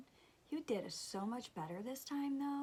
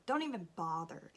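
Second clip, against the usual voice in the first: ordinary talk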